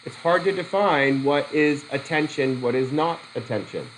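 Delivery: usual speaking voice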